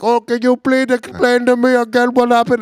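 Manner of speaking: high-pitched imitated Indian accent; retold call in an exaggerated Indian accent; thick Indian accent. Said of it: "the thickest Indian accent".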